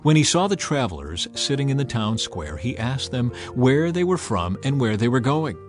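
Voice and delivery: ordinary delivery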